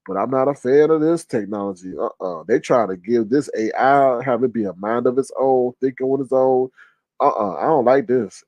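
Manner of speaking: ordinary talk, nothing marked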